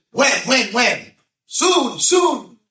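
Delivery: very high, loud mock excitement; exuberant shouting. Girl asking the rabbi when she will marry, exuberant shouts. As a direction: punch each word out in a high shout. Retold excited exchange about the blessing.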